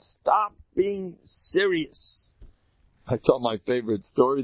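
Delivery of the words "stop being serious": stretched slow, every word weighed; slow emphatic. Acted. Rabbi repeating his advice, slow and emphatic. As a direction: drag it out to drive it home. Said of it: "repeated advice".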